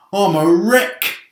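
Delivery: slow, high put-on groan. Centipede complaining after the hail, slow hammed-up groan. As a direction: moan it as a battered bug. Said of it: "groaned the centipede".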